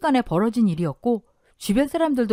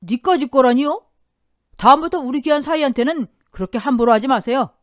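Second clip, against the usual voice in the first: firm rebuke; 6 semitones higher, 7 dB louder than usual. A narrator's reading. Father-in-law scolds the uncle.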